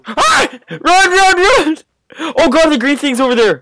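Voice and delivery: shrill terrified yelling; panicked screaming. Real, not acted. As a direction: shriek and flee. Monster chasing the group.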